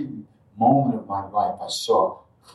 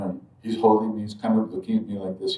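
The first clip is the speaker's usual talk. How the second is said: ordinary talk, nothing marked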